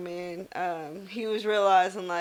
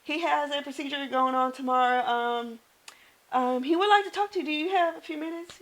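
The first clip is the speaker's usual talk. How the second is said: high, polite professional phone greeting with a nervous laugh; 7 semitones higher than usual. Retold or imitated. Nurse cold-calling a patient's daughter, high and polite. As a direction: put on your friendly hospital-caller voice.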